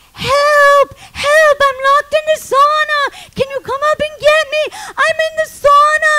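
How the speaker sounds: loud, high calls for rescue; yelling for help. Retold or imitated. Trapped woman calling to be let out of the sauna, yelling. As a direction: shout up high to someone out of earshot. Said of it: "so I start going".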